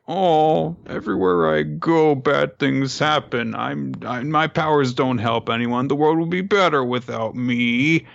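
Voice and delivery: lightly hammed despair; mock self-pity. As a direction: moan it like a misunderstood villain. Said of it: "Zanzage is just like".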